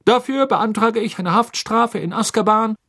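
loud, high official voice; stern judge. Acted. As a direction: demand the sentence coldly. Crouch demands sentence. (strenger Richter)

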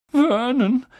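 high, quavering appeal; trembling voice. Acted. Wife frightened by the letter, trembling high voice. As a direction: say the name full of dread. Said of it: "sagte Tante Petunia mit zitternder Stimme".

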